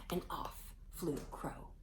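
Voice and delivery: slow whispered narration; slow storyteller's whisper. A narrator's reading. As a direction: hush it, slowly. Story hush.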